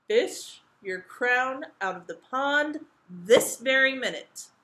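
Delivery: loud, high, slow royal command; big angry king's order; crying out. A narrator's reading. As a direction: demand it with every word hammered. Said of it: "cried the king".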